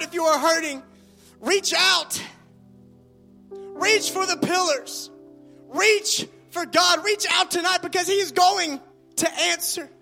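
booming motivational speech. Acted. Preacher urging the congregation.

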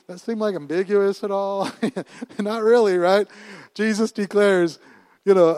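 ordinary talk, nothing marked

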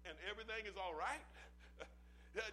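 ordinary delivery

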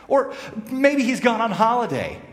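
high mocking jibe; mock the silent god gleefully; high taunt from Elijah at the prophets. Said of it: "he's taunting them".

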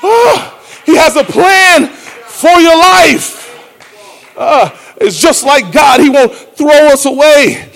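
high, loud preaching build; preacher shouts that God recycles people; full-force preaching. Acted. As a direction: preach it up loudly.

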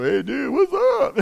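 high, drawn-out buddy voice; mock chummy greeting. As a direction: stretch it out like a goofy pal calling up.

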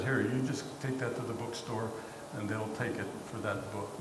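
a hint of a pastor soothing a child; gentle pastor.

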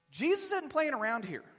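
usual speaking voice

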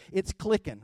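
usual speaking voice

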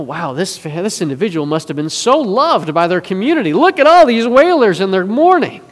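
impressed onlooker. Retold or imitated. Onlooker admires the hired wailers, mock-impressed. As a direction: marvel at it, swelling to a loud finish.

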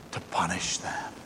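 hushed, breathy stage whisper; dramatic whisper. Acted. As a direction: drop to a whisper for effect. Dramatic drop on key word.

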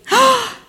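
very high startled gasp; acted gasp. Storyteller finds the count short, high shocked gasp.

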